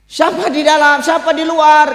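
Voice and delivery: high, loud challenge from behind a door; guard bellowing who is there; angel Malik calling. Acted. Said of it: "Kata malaikat Malik". (malaikat Malik bertanya lantang dari balik pintu)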